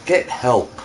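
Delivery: normal talking voice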